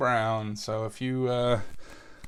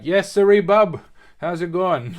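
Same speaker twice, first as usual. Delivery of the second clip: put-on northern Maine accent; 6 semitones higher, 10 dB louder than usual. Local from way up north greeting, loud Maine accent. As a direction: boom it out like a Maine old-timer. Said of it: "they're like".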